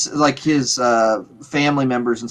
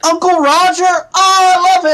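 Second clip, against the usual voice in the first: much higher and louder, thrilled fan; real excitement, pitched way up; delighted squeal; 7 dB louder than usual. Real, not acted. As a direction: gush about a favourite comedian. Fan hears favourite comedian named.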